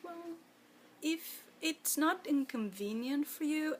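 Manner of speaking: a hint of a small, hushed child's voice; timid little boy. Sister imitating her hungry little brother, a touch of his timid, hushed voice. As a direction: lightly voice a shy young boy, barely audible.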